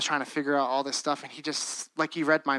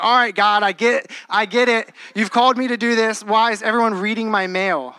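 louder, higher mock-exasperated plea; 4 semitones higher, 5 dB louder than usual. Preacher retelling his exasperated reply to God about being called, exasperated. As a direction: protest upward as if fed up.

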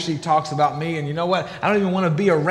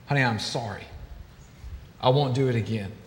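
much lower and softer, sorry; sheepish apology; 7 semitones lower, 7 dB quieter than usual. Retold or imitated. Husband apologizing to his wife, low and soft. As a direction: hang your head and apologize.

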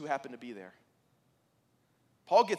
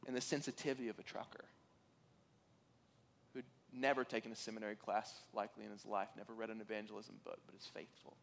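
1 moved, fading; 8 semitones lower, 14 dB quieter, faster than usual. Real, not acted.

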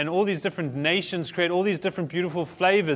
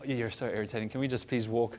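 grumpy irritation; 6 semitones lower, 9 dB quieter than usual. Retold or imitated. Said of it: "people more like myself going".

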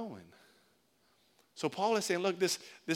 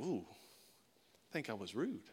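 1 quiet realization; 9 semitones lower, 12 dB quieter, slower than usual. Pretend. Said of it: "you're gonna be like".

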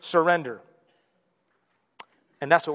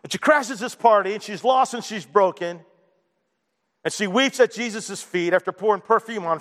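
usual speaking voice

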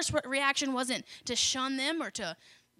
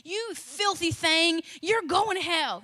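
mock condemnation; 5 semitones higher, 3 dB louder than usual. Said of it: "And Jesus didn't say".

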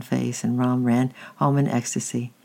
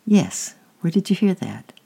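surprised; 5 semitones higher than usual. A narrator's reading. Aunt surprised by boy's words.

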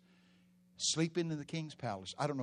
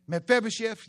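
calling out; 10 semitones higher, 10 dB louder than usual. Acted. Calling out for the cripple.

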